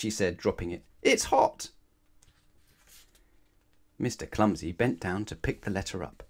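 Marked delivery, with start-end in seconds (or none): 1.0-1.7 squeaky yelp. Acted. Burnt by the hot letter.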